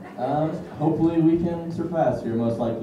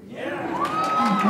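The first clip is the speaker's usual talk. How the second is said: falsetto whoop; 4 dB quieter than usual. Retold or imitated. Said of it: "whole different animal".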